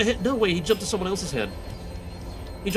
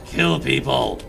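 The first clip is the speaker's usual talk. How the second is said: low, dark command; deep sinister voice; 5 semitones lower, 4 dB louder, much slower than usual. Acted. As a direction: growl it low. Shadow voice in head.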